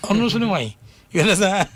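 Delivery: laughing while making the point. Real, not acted. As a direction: chuckle through the words.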